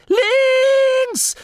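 very high, drawn-out screech; piercing screamed command; shriek. A narrator's reading. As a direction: shriek the order in panic. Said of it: "kreischte Angelo".

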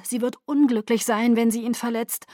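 usual speaking voice

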